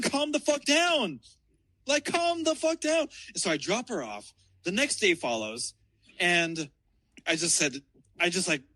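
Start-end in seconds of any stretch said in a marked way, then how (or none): 0.0-3.1 high-pitched, worked-up re-enactment; exasperated plea. Retold or imitated. Driver telling his girlfriend to calm down, high exasperation. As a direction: plead high, at the end of your rope. Retelling his side of a quarrel.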